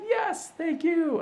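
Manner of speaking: high, slow, gushing thank-you; imitating thrilled clients, drawn out; grateful thanks. Clients thanking Chris for the Clark referral, high and grateful.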